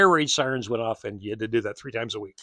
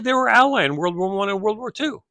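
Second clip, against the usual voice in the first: retold insistence; 10 semitones higher, 6 dB louder than usual. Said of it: "I said".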